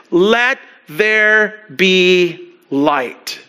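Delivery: slow, drawn-out proclamation; booming biblical narration. Acted. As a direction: pronounce it like God speaking. Quoting God's creation command.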